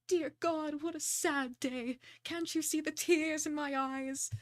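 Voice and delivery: slow, melodramatic lament; hammed-up mourning, words drawn out; grieving dame. Grieving sister laments to the detective, theatrical sorrow.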